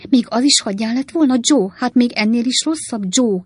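put-on high, shocked old lady's outcry; appalled exclamation in a lightly voiced old woman; exclaiming. A narrator's reading. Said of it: "kiáltott fel az öreg néni".

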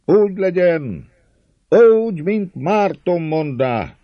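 high, loud drawn-out shout; roaring crowd. A narrator's reading. Crowd roaring agreement with Márton, loud high cheer. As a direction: bellow assent like a whole crowd. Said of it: "Ordít a száz hang".

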